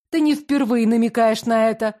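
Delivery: plain reading voice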